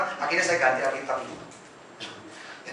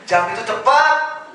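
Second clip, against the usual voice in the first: eager, drawn out; 7 semitones higher, 6 dB louder, slower than usual. Retold or imitated. Eagerly recalling waiting.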